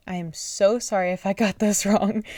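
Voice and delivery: sorry, with a laugh breaking in; laughing apology. Real, not acted. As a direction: giggle through the apology. Laughing apology over name reading.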